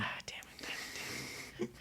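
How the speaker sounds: muttered damn it under breath; frustrated whisper. Retold or imitated. Frustrated at own Farsi.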